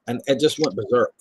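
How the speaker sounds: normal talking voice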